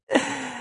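brief, high, light laugh; a quick acted chuckle, pitched high; short laugh. A narrator's reading. Martin's turn to laugh at Nilo, short high laugh. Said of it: "it was Martin's turn to laugh".

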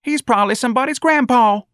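very high, loud scolding; shrieked high protest from the crowd; woman screaming. A narrator's reading. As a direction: scream it at a policeman. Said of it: "a woman screamed".

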